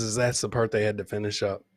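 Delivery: ordinary talk, nothing marked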